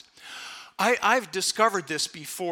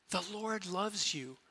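much softer and slow; tender reassurance; 9 dB quieter, slower than usual. Real, not acted. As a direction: reassure them gently. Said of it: "tender reassurance after prayer".

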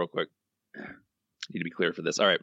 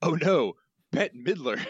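higher-pitched cartoon crook; Snake; 7 semitones higher, slower than usual. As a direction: exclaim it in a high crook voice. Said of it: "your best snake voice".

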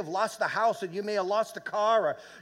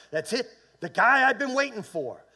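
raised, louder burst of excitement; excited exclamation; 5 semitones higher, 7 dB louder than usual. Acted. Blind man hears Jesus is coming, excited. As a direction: blurt it out, thrilled.

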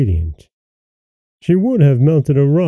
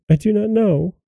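higher-pitched, understated plea of denial; lightly voiced frightened wife, pitched up; frightened cry; 9 semitones higher than usual. A narrator's reading. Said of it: "cried the poor woman, paler than death".

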